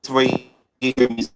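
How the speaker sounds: breaking up over a weak signal; fading in through static. Real, not acted. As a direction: voice cutting out on a bad line. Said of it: "Uh-oh, you're, you're breaking up".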